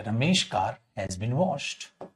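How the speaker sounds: रमेश कार said very slowly, awkwardly joined; slow, soft demo of रमेश कार; wrong reading. Shown as a demonstration. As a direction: show how रमेश कार trips the tongue. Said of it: "आपने ऐसे दे दिया".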